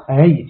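هيت said low as "hayti"; "i" closing هيت; kasra ending. Shown as a demonstration. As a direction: say هيت as hay-ti, dropping in pitch. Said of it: "أو مبني على الكسر".

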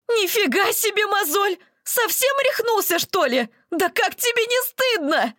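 high, indignant scolding; outraged. Acted. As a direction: tell him off in disbelief. Masha outraged.

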